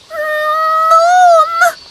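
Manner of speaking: high, loud call of a little frog; crying out. A narrator's reading. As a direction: yell for mum. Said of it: "cried Zebedee".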